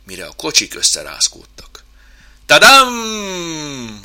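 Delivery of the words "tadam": loud, high drawn-out fanfare; whooping cry. Acted. Chimp starts up the ride, whooping. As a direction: whoop it like a showman's reveal.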